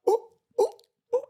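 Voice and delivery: squealing high ooh ooh; hammed-up excited squeals. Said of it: "doing a".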